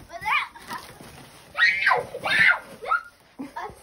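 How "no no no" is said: high panicked no; shout. Real, not acted. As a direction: shout it in alarm. Being dropped from box, panics.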